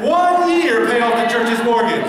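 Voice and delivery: high, bright proclamation of big news; excited announcement. Acted. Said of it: "so pretend I just said that".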